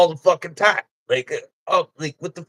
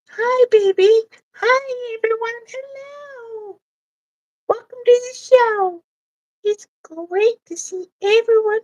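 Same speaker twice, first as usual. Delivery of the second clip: squeaky high, cheery host voice; talking vagina welcoming the show, high and chirpy; 14 semitones higher, slower than usual. Acted. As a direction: greet the crowd in a tiny high voice. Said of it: "But you gotta talk like that".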